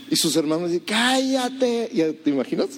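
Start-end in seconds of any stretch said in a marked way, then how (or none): 0.9-1.9 high mocking brother's hush-up; mock big-brother put-down; scornful older brother. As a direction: tell the kid brother to shut up, sneering. Older brothers silencing David.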